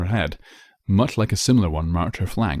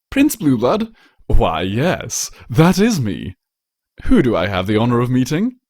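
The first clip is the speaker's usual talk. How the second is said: louder, higher grand royal greeting; pompous prince playing to fans; charming prince; 5 semitones higher, 5 dB louder than usual. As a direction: flash a winning smile as you speak. Blueblood plays along grandly.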